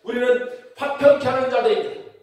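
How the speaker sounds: loud, high-pitched preaching to the congregation; full-force preaching. Real, not acted. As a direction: proclaim it at full force, driving toward each amen.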